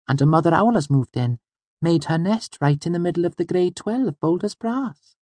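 put-on very high girl character, telling news; chatty Auri. A narrator's reading. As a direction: share the news in a high-pitched girlish voice.

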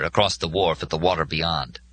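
ordinary delivery

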